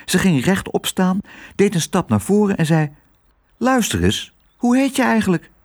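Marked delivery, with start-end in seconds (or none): 3.6-5.5 put-on high, bold child voice; high-pitched, assertive little girl; plucky girl. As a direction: confront the dog with sudden courage. Girl boldly confronts the dog.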